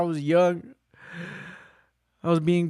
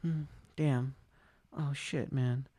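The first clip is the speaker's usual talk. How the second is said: muttered dismay; 10 dB quieter, slower than usual. Retold or imitated. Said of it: "I was just like".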